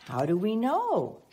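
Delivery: ordinary talk, nothing marked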